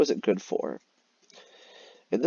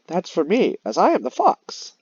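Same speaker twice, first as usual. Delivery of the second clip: high, loud greedy fox; sly fox voice; 7 semitones higher, 4 dB louder than usual. Acted. Teacher rereading the fox claiming the cheese, high and cocky.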